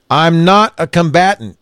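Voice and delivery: man calling to armed police again, loud steady voice; loud but steady. A narrator's reading. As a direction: repeat it loudly, keep it even. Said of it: "loudly but in a steady voice".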